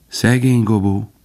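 Faline's lighter, wistful poor Gobo; light sigh. A narrator's reading. Faline lightly sighing over lost Gobo. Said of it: "Könnyedén sóhajtott".

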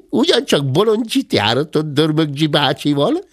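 put-on high, giggling child's voice; laughing. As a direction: laugh the words out in delight. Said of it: "nevetett Zebike".

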